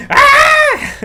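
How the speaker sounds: shrill high clown cackle and shriek; wicked cackle. Pretend. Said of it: "I forgot to do a clown impression".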